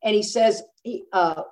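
ordinary delivery

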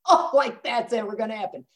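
put-on incredulous sarcasm; mock scoffing. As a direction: imitate a disbelieving audience. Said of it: "I know you're going".

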